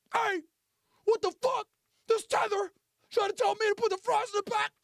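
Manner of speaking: high-pitched mock outrage; hammed-up angry protest; mad. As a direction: spaz out in indignant high voice. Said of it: "You get all mad".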